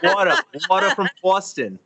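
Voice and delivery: hammed-up Boston accent. Shown as a demonstration. East Coaster showing Californians the local speech, Boston accent. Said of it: "the way you East Coasters speak".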